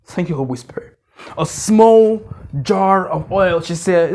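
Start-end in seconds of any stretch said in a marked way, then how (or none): 0.1-1.0 breathy whispered aside to the Spirit; hushed prayerful whisper. Real, not acted. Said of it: "whispered aside in sermon".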